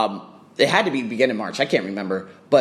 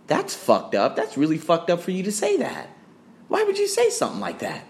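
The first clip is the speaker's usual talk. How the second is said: rising to a high incredulous question; mock disbelief; 5 semitones higher than usual. Clients reacting to the gym's email, mock appalled. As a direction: imitate an appalled client, pitch climbing.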